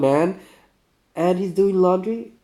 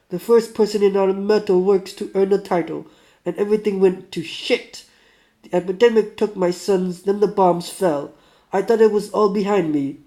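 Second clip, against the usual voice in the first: put-on higher, louder Russian accent, aggrieved; 5 semitones higher, 6 dB louder than usual. Sick former shock worker lamenting his lost life, aggrieved Russian accent. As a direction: protest your lot in a Russian accent. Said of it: "do my best, uh, Russian".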